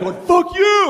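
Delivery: imitated high-pitched road-rage yell; furious driver. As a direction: mimic a furious driver swearing through glass.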